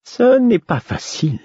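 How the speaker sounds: high, halting fool voice; hesitant Fool voice. A narrator's reading. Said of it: "hésitant".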